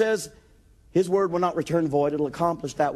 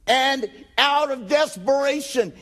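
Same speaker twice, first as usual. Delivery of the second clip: ordinary talk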